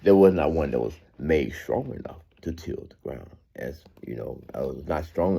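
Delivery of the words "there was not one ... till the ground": slow, deliberate Bible reading; scripture reading. Acted. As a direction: read it out word by word. Reads verses slowly.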